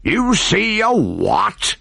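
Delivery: slow, disbelieving high question; can't believe his ears; high drawn-out startled question. A narrator's reading. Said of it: "asked the startled captain".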